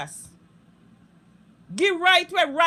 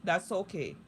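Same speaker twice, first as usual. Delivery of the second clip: mock calm; 8 semitones lower, 8 dB quieter than usual. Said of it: "me say".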